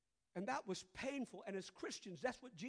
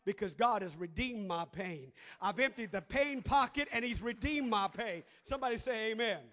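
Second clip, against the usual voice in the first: louder preaching voice; preaching rising; 2 semitones lower, 6 dB louder than usual. Real, not acted. Pastor preaching redemption to the congregation, building louder. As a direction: preach it with growing force.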